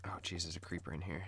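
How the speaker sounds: hushed unvoiced whisper. Real, not acted. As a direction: whisper it so the creeper won't hear. Spots creeper while playing.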